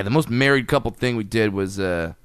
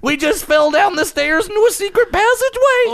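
very high, loud cartoon yelp; Shaggy; 5 dB louder than usual. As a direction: cry out like a panicked cartoon sidekick, pitched way up. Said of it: "the Scoob does".

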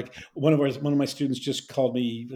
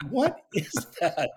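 baffled; 4 semitones higher than usual. Retold or imitated. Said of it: "And they would go".

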